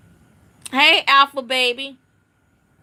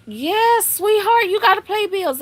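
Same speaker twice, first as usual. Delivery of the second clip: mock sweetness; 8 semitones higher than usual. Mother teasing her daughter about paying bills, high sugary sarcasm.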